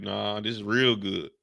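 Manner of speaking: usual speaking voice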